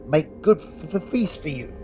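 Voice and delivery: high, stuttering little creature gloating over food. Acted. Creature sizing up the plump prisoner, high and gloating. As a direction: squeak it out like a hungry goblin-dog.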